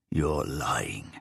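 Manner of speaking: whispered accusation; husband hissing at the killer, barely voiced; hushed accusation. A narrator's reading. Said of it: "Lee accused".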